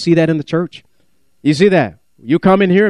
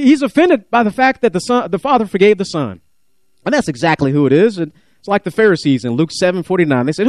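ordinary delivery

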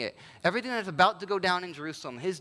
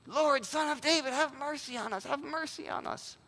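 much higher, strained calling out for mercy; pleading cry; 7 semitones higher, 4 dB quieter than usual. Retold or imitated. Blind beggars crying out to Jesus, high strained pleading. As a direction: cry out over the crowd, begging. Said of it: "all the louder".

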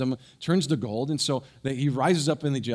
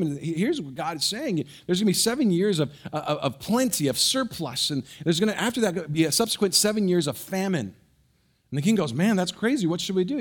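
raised, high storytelling preaching; lively preaching; 6 semitones higher than usual. Acted. Pastor telling the congregation of Joseph's rise, fervent. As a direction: drive the story forward from the pulpit.

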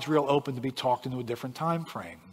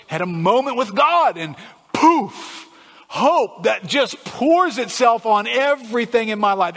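louder, fervent, rushing through the list; impassioned preaching; 7 semitones higher, 7 dB louder than usual. Real, not acted. Preacher wishing for instant hope from prayer, fervent full-force preaching. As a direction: pile up the wishes, punching "poof".

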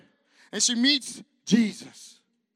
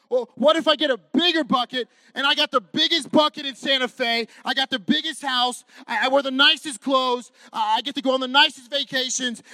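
louder boastful list of riches; mock bragging; 3 semitones higher, 7 dB louder than usual. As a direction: show off, hammed-up. Mocking bragging about riches.

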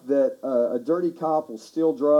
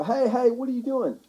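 higher-pitched "hey, hey" of an onlooker; startled bystander challenge; challenging bystander; 8 semitones higher than usual. Acted. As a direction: call out to stop someone in the act. Bystander calling out to car takers.

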